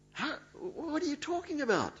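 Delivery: baffled woman. Acted. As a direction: act out her confusion.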